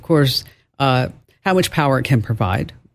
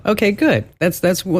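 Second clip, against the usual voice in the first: ordinary talk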